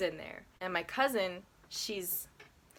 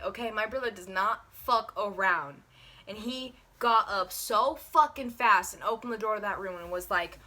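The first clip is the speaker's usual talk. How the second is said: ordinary delivery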